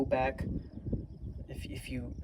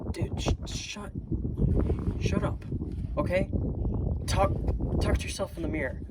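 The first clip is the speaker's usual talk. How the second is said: higher, fed-up "shut up, okay?"; exasperated scolding; 6 semitones higher than usual. Real, not acted. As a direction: snap at whiners to knock it off. Telling viewers to stop whining.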